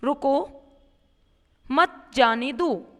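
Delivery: रुको, a clear break, then मत जाने दो; pause after रुको. Shown as a demonstration. Teacher showing students a short comma stop, pause after रुको. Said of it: "थोड़ी देर के लिए रुक कर जब बात करनी होती है".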